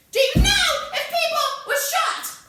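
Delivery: very loud, high-pitched yelled demand; interrogator's furious shout. Acted. Interrogator demands an answer from Sonny, furious shout. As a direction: explode at the suspect.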